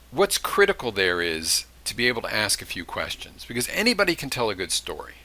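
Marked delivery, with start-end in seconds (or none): none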